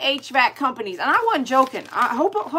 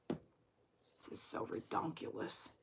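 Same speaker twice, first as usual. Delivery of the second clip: slow, breathless whisper; slow whisper at the screen, exasperated; 22 dB quieter, slower than usual. Real, not acted. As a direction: mutter it under the breath, slow. Exasperated at duplicate lives.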